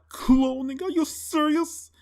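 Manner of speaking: high-pitched, disbelieving questions; outraged disbelief, voice climbing high; incredulous outburst. Acted. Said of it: "And I'm like".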